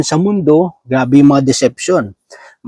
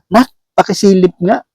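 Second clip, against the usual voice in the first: lightly voiced mother, a bit higher; mock mother asking her child, pitched up; 4 semitones higher than usual. Mother asking her child to check the coffin, higher mock voice. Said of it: "sabi ng nanay dun sa anak".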